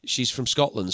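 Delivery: usual speaking voice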